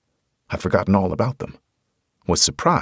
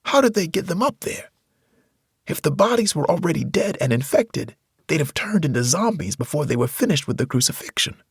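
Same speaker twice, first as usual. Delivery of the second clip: frightened Tony; 10 semitones higher than usual. A narrator's reading. Tony voiced high and shaken.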